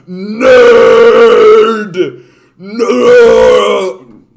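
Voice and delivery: loud high mock yell; teasing shout. Teasing a Trekkie in chat, loud mock yell. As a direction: bellow it like a schoolyard taunt.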